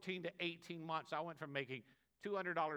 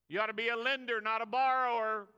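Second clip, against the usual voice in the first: loud, higher, building exhortation; preaching at full force; 6 semitones higher, 9 dB louder than usual. Acted. Preacher exhorting the church to lend, shouting. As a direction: drive it home to the congregation. Repeat louder.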